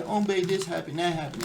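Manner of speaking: mocking a boyfriend who always complains; mock whiny boyfriend. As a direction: whine about a bad day to mock him. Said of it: "your man always coming home being emotional".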